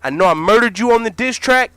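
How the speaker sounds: firm pushback. Acted. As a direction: warn him not to come at you.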